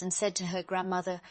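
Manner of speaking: usual speaking voice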